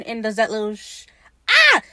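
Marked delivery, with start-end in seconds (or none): 1.5-1.8 short, high, loud fangirl shriek; excited scream. Real, not acted. Fan reacting to the way he turns around, excited scream. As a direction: burst into a thrilled squeal. Fan thrilled by Blackbeard's turnaround.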